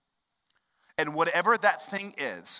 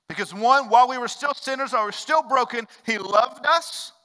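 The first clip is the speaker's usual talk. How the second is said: higher and louder, building; preacher proclaiming God's love, rising and louder; preaching climax; 9 semitones higher, 7 dB louder than usual. Acted. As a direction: lift it and proclaim it.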